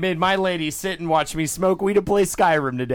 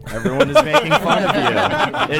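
laughing meditation voice; 10 semitones higher, 3 dB louder, faster than usual. Pretend. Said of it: "lead us on a guided meditation".

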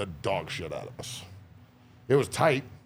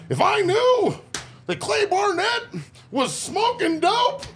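very high, loud, slow squeaky voice; Barnett impression; 6 dB louder, slower than usual. Host doing his old coach Barnett, very high and slow. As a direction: stretch every word out way up high. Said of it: "only one thing I can do for Barnett".

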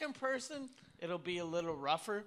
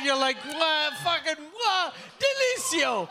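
loud, high chef's kiss; 6 semitones higher, 6 dB louder than usual. Retold or imitated. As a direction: smack your lips like a chef. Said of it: "you know when you're eating something good".

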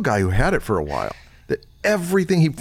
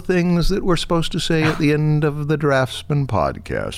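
announcer voice. Acted. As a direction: wrap up the show like a TV announcer. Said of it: "I could do the announcer voice thing".

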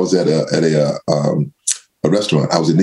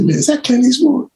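much higher, eager question to the owner; excited whisper-question. Retold or imitated. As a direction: ask it in excited disbelief. Asking owner about celebrity.

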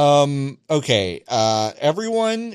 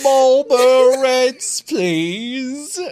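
high, slow, laughing posh-British order; mock British accent; 11 semitones higher, 6 dB louder, much slower than usual. Imitating the teen buying cigarettes, mock British accent. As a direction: drag it out in a hammed-up British voice, laughing. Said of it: "Hello, I need a pack of Marlboro Reds".

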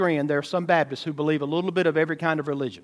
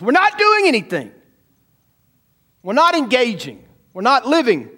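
higher, louder and climbing; rising preaching cry; 6 semitones higher, 4 dB louder than usual. Real, not acted. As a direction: drive the confession up to a cry. Preacher builds to a shout.

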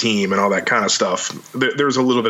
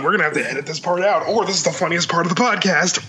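usual speaking voice

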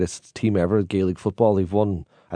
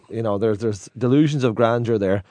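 ordinary delivery

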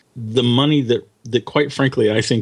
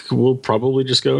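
normal talking voice

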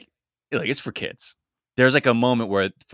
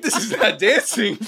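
higher, mock-exasperated judgement; exasperated verdict; 11 semitones higher than usual. Watching the audition and judging it, high exasperated. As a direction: dismiss the dancing, then give in.

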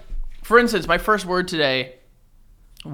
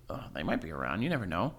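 much lower, much softer ominous murmur; low mysterious voice; 9 semitones lower, 12 dB quieter, faster than usual. Real, not acted. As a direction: say it low and spooky.

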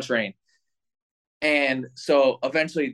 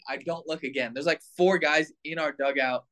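ordinary talk

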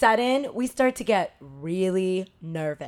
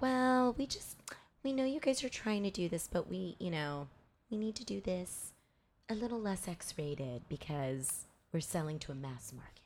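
very soft, hesitant half-whisper; mock tactful murmur; hushed apologetic editors; 3 semitones lower, 13 dB quieter than usual. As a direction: imitate a delicate, reluctant letdown under the breath. Imitating editors gently turning project down.

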